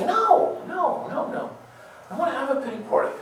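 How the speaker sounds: very high, fast whining protest; whiny complainer. Retold or imitated. Self-pitying person insisting on the pity party, high whine. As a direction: sulk like a child refusing to budge.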